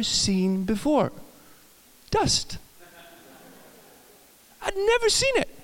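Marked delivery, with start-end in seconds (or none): 2.1-5.5 mock astonishment. Kid sees dust, astonished.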